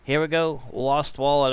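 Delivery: ordinary delivery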